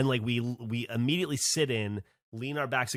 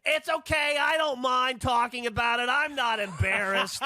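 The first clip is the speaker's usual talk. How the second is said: high put-on voice, breezy and unashamed; unbothered Kate; 12 semitones higher than usual. To the British women, a high mock breezy reassurance. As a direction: shrug it off like it's nothing. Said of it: "and Kate goes".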